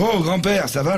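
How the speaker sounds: high, kindly doctor. Acted. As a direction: check on the old man. Doctor speaks to grandfather.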